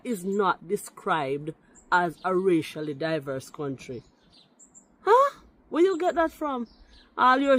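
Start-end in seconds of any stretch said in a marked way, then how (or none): 5.0-7.6 high-pitched incredulous huh and question; indignant challenge. Real, not acted. Commenter challenging a rival's claim about Jamaica, indignantly incredulous. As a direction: throw the question back in sharp disbelief.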